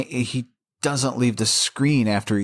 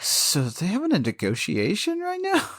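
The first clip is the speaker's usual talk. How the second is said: mimicking co-host; 9 semitones higher than usual. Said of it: "'cause you were like".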